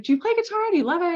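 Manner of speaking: bubbling over with enthusiasm; quizzing the client about his guitars, high excited questions. Retold or imitated.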